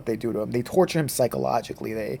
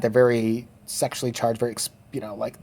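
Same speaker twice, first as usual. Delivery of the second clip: ordinary delivery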